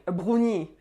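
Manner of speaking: "brownie" as French "broo-nee", throaty R; French accent. Shown as a demonstration. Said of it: "say them with the French accent".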